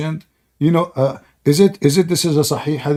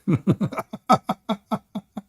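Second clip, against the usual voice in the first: high laugh; 5 semitones higher, 6 dB quieter than usual. Real, not acted. Laughing at own joke.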